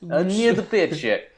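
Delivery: high-pitched mock Russian accent; hammed-up Russian line reading; bad Russian accent. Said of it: "worst Russian accent".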